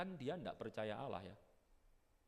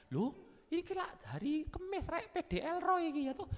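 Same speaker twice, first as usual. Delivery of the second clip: high Javanese-flavoured chirp; eager churchgoer; 5 dB louder than usual. Pretend. Churchgoer cheerfully heading to service, high and chirpy. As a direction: sound keen for Thursday service.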